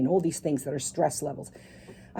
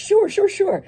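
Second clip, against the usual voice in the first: high, loud, rapid-fire sure sure sure; mock eager agreement; 7 dB louder than usual. Wife brushing off husband in the café, high and eager. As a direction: brush him off with bright fake eagerness. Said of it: "I'm like".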